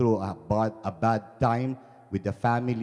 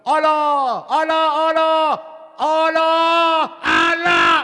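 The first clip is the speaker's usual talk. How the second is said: high, full-force falling cry; shouting; 10 dB louder, slower than usual. Acted. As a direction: yell it over and over as you plummet. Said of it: "he was shouting".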